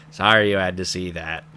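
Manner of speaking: low, soft, flat-toned creepy aside; deadpan creepy line. Retold or imitated. Creepy character apologizing to the viewer, low and hushed. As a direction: drop low and quiet like an eerie apology. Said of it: "and he just goes".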